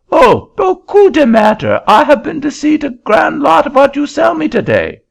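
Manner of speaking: put-on very high, loud French-accented protest; an indignant Frenchman in a shrill-high French accent; angry French accent. Frenchman refusing the auctioneer's seat, high loud French accent.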